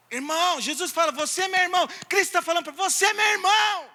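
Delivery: high, loud, fast exclamation; fired-up preaching. Real, not acted. Preacher telling the church Christ calls them brother, exclaiming. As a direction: proclaim it with fire.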